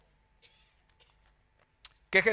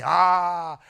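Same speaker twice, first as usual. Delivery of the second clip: enthusiastic driver; 2 semitones higher, 3 dB louder, slower than usual. Retold or imitated. Driver recommending a pastry shop, enthusiastic imitation. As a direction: open on a loud "ah", then rave in a raised pitch. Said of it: "Y me dice".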